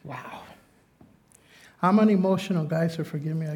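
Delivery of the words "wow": a breathless whispered wow; hushed, real awe; awed whisper. Real, not acted. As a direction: barely breathe the word, overcome. Overcome after reading the verse.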